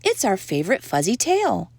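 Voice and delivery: plain reading voice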